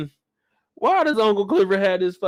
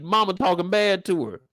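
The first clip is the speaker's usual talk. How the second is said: ordinary delivery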